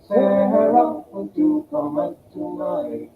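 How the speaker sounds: ordinary talk, nothing marked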